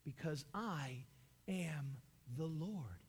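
quiet and grave; hushed voice of God. Acted. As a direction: draw them in with a low, grave hush. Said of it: "And he says".

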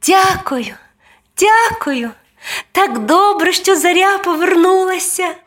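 high, tiny angel voice; high-pitched, joyful small-child thanks; grateful little angel. A narrator's reading. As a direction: thank them with delighted relief.

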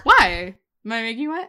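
high, playful retelling with a rising end; mock-flirty quote. Repeating his sext to a co-host, high and teasing. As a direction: punch WHY high, then lift WET.

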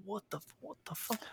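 retelling a baffling movie line, hushed disbelief; baffled mutter. Retold or imitated. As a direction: drop it to a stunned near-whisper. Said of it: "And it's just like".

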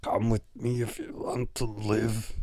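quoting a terse movie hero, low and quiet; a lightly voiced action-movie line, low. Host quoting Terminator two to listeners, a low terse line. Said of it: "another character in the movie says that".

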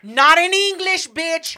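high, loud, slow playful yell; loud mock protest. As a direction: shout it, half joking outrage.

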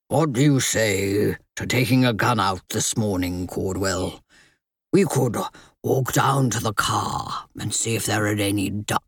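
low, easy male voice; friendly gentleman. Acted. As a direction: offer it casually and kindly. Sebastian offers distraction.

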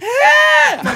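high, loud startled yelp; startled scream. Retold or imitated. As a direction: shriek as the cat leaps at you. Cat leaps out of carrier.

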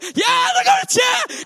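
high shrieking yell of joy; excited shout. Real, not acted. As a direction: squeal it out, thrilled. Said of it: "excited about judges turning".

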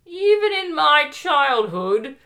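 put-on huffy British housewife accent, tutting; Monty Python style British lady, cracking up at the end; British charwoman accent. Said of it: "more like the charwomen from Monty Python".

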